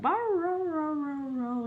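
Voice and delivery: a dog begging in howls; dog whining. Retold or imitated. Dog Halo begging mum for biscuit, high whine. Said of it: "Halo's like".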